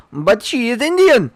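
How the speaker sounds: put-on loud, high hammy Indian accent. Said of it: "My, uh, African accent is even better".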